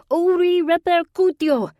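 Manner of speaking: high, loud incantation shout; shouted spell. A narrator's reading. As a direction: hurl the spell at the phantom.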